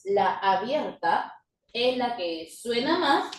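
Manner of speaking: ordinary talk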